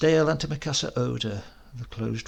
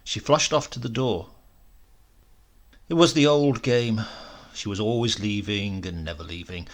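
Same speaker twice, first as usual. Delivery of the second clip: ordinary delivery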